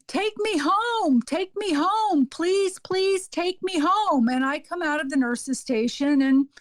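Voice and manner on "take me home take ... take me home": high, drawn-out pleading call; imitating a yelling patient. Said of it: "hear this woman yelling".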